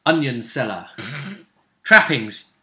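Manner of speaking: usual speaking voice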